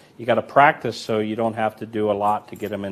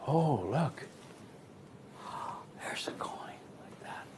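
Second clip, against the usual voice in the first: hushed aside; 3 semitones higher, 15 dB quieter than usual. Retold or imitated. Old man spots the hidden coin.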